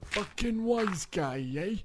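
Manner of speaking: slow, drawn-out New York wise guy; New York mobster accent. Pretend. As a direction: lean into the Brooklyn mobster drawl. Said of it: "That was really good".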